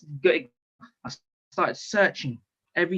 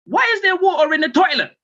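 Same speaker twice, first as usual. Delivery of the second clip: frantic, very high, rapid yelling; random shouted outburst; 5 dB louder than usual. Retold or imitated. Man losing it over water in the toilet, shrieked outburst. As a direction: explode into a high-pitched shout. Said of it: "And I literally started shouting".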